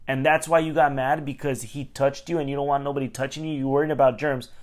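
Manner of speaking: ordinary delivery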